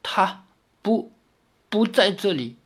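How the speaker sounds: soft, strained faltering words; dying gasp. A narrator's reading. Dying fox demon answers Li Xiaoyao, weak and faltering. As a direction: force out words while dying. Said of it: "好不容易才说出话来".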